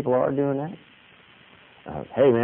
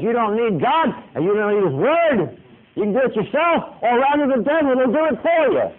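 much higher and louder, a sly hammed-up devil; devil's tempting voice; 11 semitones higher, 5 dB louder than usual. Preacher voicing the devil tempting Christians, high mock devil. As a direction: squeal it up high like a smooth-talking tempter. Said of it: "the devil comes around and says".

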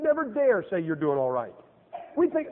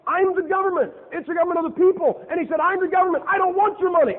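higher, louder, pompous mock voice; mock pompous caller; 9 semitones higher, 6 dB louder than usual. Preacher mocking the anti-tax brother, high and loud. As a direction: ham up a self-important know-it-all.

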